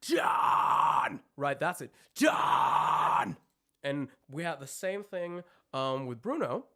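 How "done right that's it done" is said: loud unvoiced scream on done; screamed demo. Shown as a demonstration. Coach showing the student how the scream sounds. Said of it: "the math shape is not really there".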